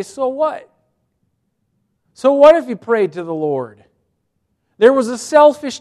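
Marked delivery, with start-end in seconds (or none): 0.0-0.7 dismissive. Real, not acted. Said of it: "I would say".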